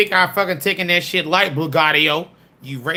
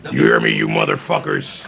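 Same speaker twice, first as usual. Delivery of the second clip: very low, booming challenge; very deep voice. Acted. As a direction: boom it from deep down. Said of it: "I gotta use my deep voice now".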